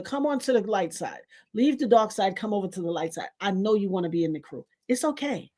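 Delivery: playful church altar call; mock altar call. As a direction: call them to the altar like a gentle preacher.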